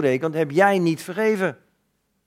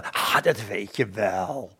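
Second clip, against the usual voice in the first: low, slow dismissive voice; evasive visitor; 8 semitones lower, 5 dB quieter, slower than usual. Retold or imitated. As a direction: brush it off, dragging the words. Man evading an apology request.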